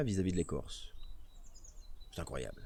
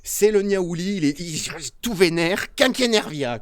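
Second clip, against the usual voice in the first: louder and higher, slow mock rage; mock fired-up; 6 semitones higher, 8 dB louder than usual. Streamer to chat, hamming up an angry tree's name. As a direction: play the tree as furious, hammed up. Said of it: "cinq fois énervé".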